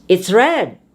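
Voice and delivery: "red" pushed up with force; stress on RED. Shown as a demonstration. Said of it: "put force into adjectives".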